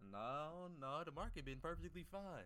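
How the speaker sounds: loud, high sarcastic denial; exaggerated mocking comeback; mock retort. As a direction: shoot down their excuse, teasing. Mock answer to lying followers.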